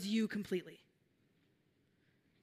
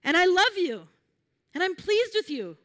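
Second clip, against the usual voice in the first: lightly voiced loving God, higher and emphatic; 4 semitones higher, 5 dB louder than usual. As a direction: affirm each phrase with lifted pitch and pauses. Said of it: "That God sees us and says".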